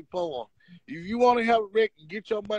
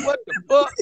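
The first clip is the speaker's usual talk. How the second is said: shocked outburst; 5 dB louder than usual. Retold or imitated. Retold shocked reaction to news.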